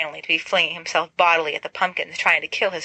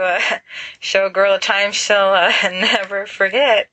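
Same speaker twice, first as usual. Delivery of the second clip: laughing awkwardly through the words; nervous laugh; 2 semitones higher, 5 dB louder than usual. Acted. James awkwardly joking to Lily, nervous laughing. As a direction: chuckle nervously as you joke.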